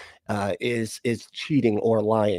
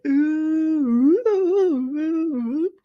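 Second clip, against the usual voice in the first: squeaky high ooh calls, imitating a chat message; high mock ooh; much slower than usual. Streamer reading out a chat ooh, high hooting. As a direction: hoot high and thin like a silly ghost.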